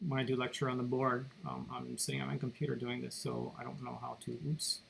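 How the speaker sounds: subdued explanation at reduced volume; unsure of volume. Real, not acted. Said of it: "Sorry about my voice being probably a different volume".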